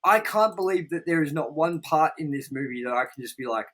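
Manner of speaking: ordinary talk, nothing marked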